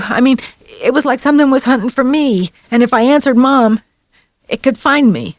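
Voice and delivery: put-on high, shaken child's voice; lightly voiced frightened boy. A narrator's reading. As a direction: tell it like a scared kid. Boy's frightened account.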